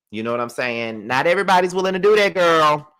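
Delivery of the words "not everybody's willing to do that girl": 'Not everybody's willing to do that' said higher, louder and fast; emphatic repeat. Real, not acted. Telling viewers not everyone would do that, emphatic louder repeat. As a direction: hammer the point home the second time.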